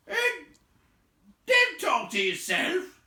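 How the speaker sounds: loud, high troll shout. A narrator's reading. Tom shouting at Bert, loud. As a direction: yell it at him. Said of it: "said Tom".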